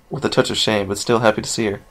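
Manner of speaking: ordinary delivery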